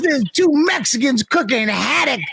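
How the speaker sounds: high-pitched mock indignation; retold angry complaint. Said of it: "he was seriously fucking angry with me".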